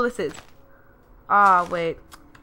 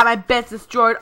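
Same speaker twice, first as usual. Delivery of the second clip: ordinary talk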